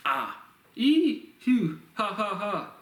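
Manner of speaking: string of high, loud nonsense cries; expressive vocalizations. Shown as a demonstration. Lecturer showing listeners sounds that are not syllables, expressive vocalizations. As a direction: burst out "ah", a high "ee", then laughing "ha ha". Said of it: "I'm making an expressive vocalization".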